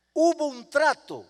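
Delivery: high, loud stern mother; mother refusing to give in, loud and stern. Retold or imitated. Mother holds firm, louder.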